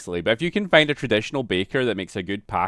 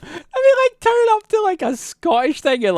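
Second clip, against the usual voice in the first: incredulous; 3 dB louder than usual. Real, not acted. Incredulous outburst.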